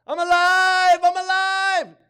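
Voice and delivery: high, loud jubilant mock cheer; hammed-up shout of joy. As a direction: cheer it out at full voice, far above your normal pitch. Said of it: "he was cheering at the time".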